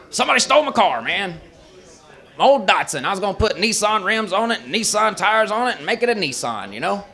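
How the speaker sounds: high, ugly put-on complaining voice; Lee. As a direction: complain about a loss in an unattractive caricature voice. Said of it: "So was his voice. He talks like".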